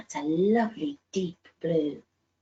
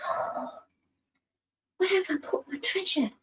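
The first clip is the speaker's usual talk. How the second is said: puzzled whisper to himself; 10 semitones higher than usual. Acted. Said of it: "George thought and thought".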